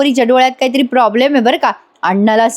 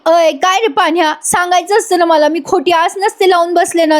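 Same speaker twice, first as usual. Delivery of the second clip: high-pitched imitated wailing complaint; mock tearful reproach; 7 semitones higher than usual. Payal confronts Panya before everyone, mock tearful. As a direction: reproach him like a jilted girl, half-acted tears. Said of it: "रडत रडत सगळ्यांसमोर बोलते".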